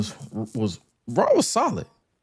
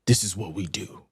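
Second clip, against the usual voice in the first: lightly voiced Triple H, sinking to a whisper; Triple H impression; 4 dB quieter than usual. Host pulling Oba aside, a quiet hint of Triple H. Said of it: "the Triple H impression".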